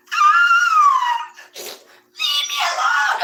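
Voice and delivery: very high, loud, slow shrieking plea; hammed-up little sister screaming in fear; terrified scream. Little sister screaming at the blinking doll, shrieking. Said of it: "And she'd be like".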